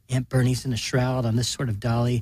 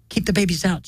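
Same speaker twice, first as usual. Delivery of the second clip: urgent higher shout; 6 semitones higher than usual. A narrator's reading. Min shouting to keep the babies away. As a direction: call out urgently. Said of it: "shouts Min".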